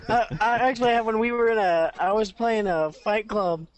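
ordinary talk, nothing marked

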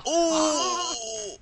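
high pained yelp like a brute just kicked in the groin; brute kicked in the nuts. Retold or imitated. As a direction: yelp in sudden groin pain. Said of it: "That's my impression of a brute getting kicked in the nuts".

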